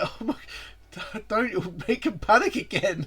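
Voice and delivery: high, loud, slow laughing protest; laughing plea. Real, not acted. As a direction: laugh while begging him to stop. Laughing plea to Naka-san.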